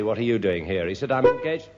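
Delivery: ordinary talk, nothing marked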